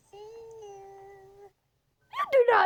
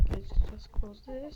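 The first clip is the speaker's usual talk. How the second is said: whisper; 5 semitones lower, 4 dB quieter than usual. Real, not acted. Hiding from grandma.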